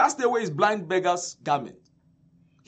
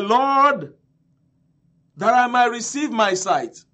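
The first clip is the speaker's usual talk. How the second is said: raised, higher-pitched declaration; firm appeal; 4 semitones higher, 5 dB louder than usual. Retold or imitated. Blind man answering Jesus, firm appeal. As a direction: call out the request firmly.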